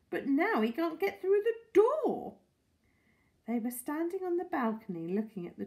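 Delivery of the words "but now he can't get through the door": put-on worried old lady. Mrs Silver worried.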